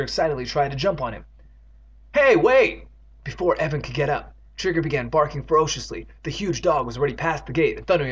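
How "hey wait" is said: high, loud alarmed call; calling after someone. Acted. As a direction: yell to stop the runaway. Character calls after runaway dog.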